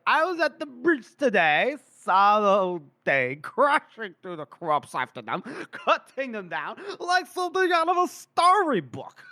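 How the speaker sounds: put-on high, swooping drunken boasting; drunk colonel. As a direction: gush tipsily about the battle. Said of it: "and he's, like, drunk".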